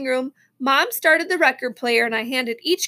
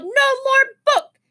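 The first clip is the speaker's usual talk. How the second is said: slow, high tantrum wail; high-pitched, drawn-out refusal; angry yell; 11 semitones higher, 4 dB louder, much slower than usual. A narrator's reading. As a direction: yell each word like a furious toddler. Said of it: "he yelled".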